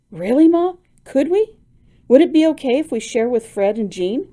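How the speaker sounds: can't believe the luck; thrilled. Acted. Daughter to mother offering money, thrilled eager asking.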